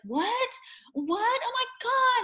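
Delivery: high, loud gasp of disbelief; acted astonishment. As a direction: squeal it like an overwhelmed mother. Mother retells shocked delighted reaction.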